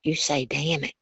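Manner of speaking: quiet whisper. Retold or imitated. Big brother whispering the trick to his little sister, softly. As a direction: murmur it like a sly older brother. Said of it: "He come whispered in my ear".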